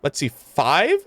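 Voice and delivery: ordinary talk, nothing marked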